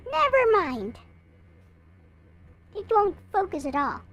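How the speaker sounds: ordinary talk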